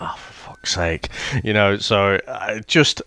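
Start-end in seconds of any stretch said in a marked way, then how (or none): 0.0-1.1 whispered Oh, for fuck's sake, breathed out almost silently; soft exasperated whisper, much softer; under the breath. Retold or imitated. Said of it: "that under the breath thing".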